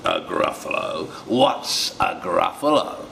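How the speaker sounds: slow, breathy fox question; a wary fox, unsure; sly fox. Acted. The fox asks the mouse about the Gruffalo, slow wary fox. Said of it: "fox asks the mouse".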